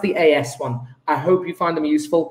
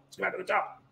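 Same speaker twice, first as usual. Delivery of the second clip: hushed repeat; 14 dB quieter, much faster than usual. Real, not acted.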